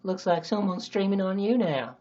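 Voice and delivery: slow, drawn-out Texas drawl; hammed-up Texas accent with menace; angry Texan. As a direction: sneer it in a put-on Texan accent. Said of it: "I don't know why he's so angry".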